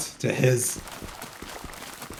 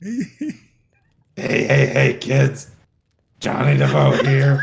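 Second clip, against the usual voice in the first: phlegmy gurgling voice, louder; throat gurgle; 3 semitones higher, 5 dB louder, slower than usual. Retold or imitated. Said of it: "Now you're just putting extra on it".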